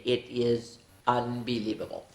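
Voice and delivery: ordinary delivery, nothing marked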